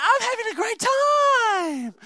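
high, slow mock-girly voice; hammed-up high girl voice; girly sister voice. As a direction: stretch it out like a carefree sister. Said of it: "that's how all girls speak".

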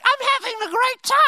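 high put-on old lady voice; hammed-up high old-lady voice; mock old lady. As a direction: chirp it like a cheerful old lady in the storm. Old lady in wheelchair quoted.

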